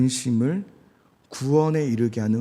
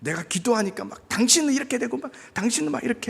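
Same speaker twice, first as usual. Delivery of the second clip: pushy pray-er; 9 semitones higher than usual. Retold or imitated. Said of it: "막 강하게".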